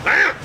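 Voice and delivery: sudden loud bark-like cry; short, startling mock bark; imitated dog outburst. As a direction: mimic a dog bursting out at you. Imitates noise that startled him.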